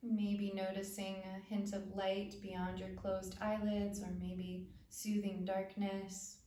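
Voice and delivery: calm, spacious phrases with long pauses; unhurried body-awareness meditation voice; guided meditation. Acted. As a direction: guide attention gently through the body.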